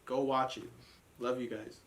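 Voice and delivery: ordinary talk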